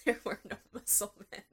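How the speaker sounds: quick denial through real laughter; laughing protest. Real, not acted. Co-host denying the muscle men joke, laughing. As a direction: protest while still laughing.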